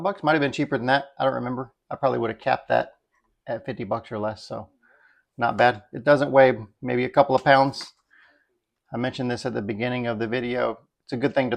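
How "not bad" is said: ordinary talk, nothing marked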